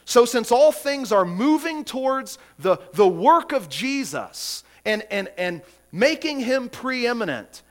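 high, loud proclamation; fervent preaching. Acted. Pastor proclaiming every knee will bow, loud and fervent. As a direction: ring it out over the pews.

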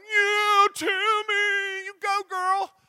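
very high, loud, drawn-out mock cheer. Pastor acts out cheering a colleague on, whooping. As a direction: whoop it up like a fan.

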